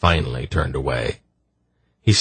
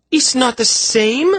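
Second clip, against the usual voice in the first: put-on high, objecting girl's voice; young woman voice; 4 dB louder than usual. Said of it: "she objected".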